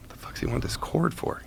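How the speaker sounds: whispered thought. Retold or imitated. Inner thought during cell exchange.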